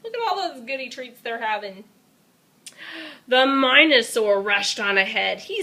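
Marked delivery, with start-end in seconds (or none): none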